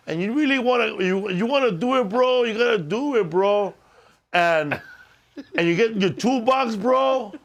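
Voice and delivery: J-Rod voice. Retold or imitated. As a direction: hype it up like an Instagram electrician kid. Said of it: "Check out this guy, man, um, J-Rod Electrics".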